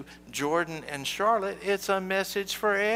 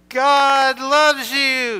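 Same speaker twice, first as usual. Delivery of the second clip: very loud, high, drawn-out yell; outside-voice yell; 9 semitones higher, 15 dB louder, much slower than usual. Shown as a demonstration. Calling to a friend across the playground, full yell. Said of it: "use your outside voices and you yell".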